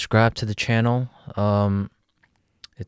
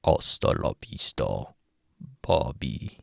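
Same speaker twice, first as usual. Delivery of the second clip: Terminator impression; 8 semitones lower, 5 dB quieter than usual. Second take, deeper movie voice.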